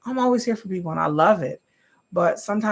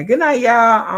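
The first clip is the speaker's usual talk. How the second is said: louder, upbeat sign-off; loud goodbye; 7 semitones higher, 10 dB louder, slower than usual. Real, not acted. Streamer calling good night loudly to her viewers.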